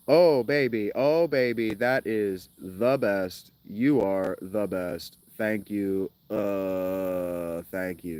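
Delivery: stilted, slow narrator doing pillow talk; mocking reader as lover. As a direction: imitate the reader's overdone delivery, slowing as it goes. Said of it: "Do you think he talks like".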